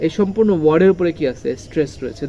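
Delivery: normal talking voice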